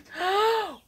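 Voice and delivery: high sudden gasp; sharp gasp. Real, not acted. Streamer spots another surprise in chat, sharp high gasp. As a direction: catch your breath in shock.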